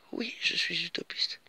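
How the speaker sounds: slow soft whisper. Real, not acted. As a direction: confide it in a whisper. Whispered self-declaration.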